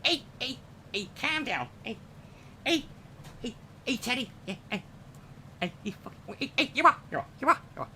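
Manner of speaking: high, comic Scouse calm down lads; hammed-up Liverpool bickering, pitched high; mock Scouser squabbling, high and jabbing; Scouse impression. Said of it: "the three fr-, from the Harry Enfield show".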